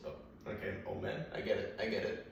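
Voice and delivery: meek, hushed mock-dog voice; quietly backing down as the dog; submissive dog. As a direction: give in softly, like a cowed dog. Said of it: "My dog would just submissively look at".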